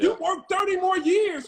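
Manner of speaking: loud, high, fed-up shout; exasperated shout. Real, not acted. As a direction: yell it to shake them awake. Heated argument about working jobs.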